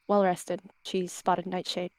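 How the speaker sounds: ordinary delivery, nothing marked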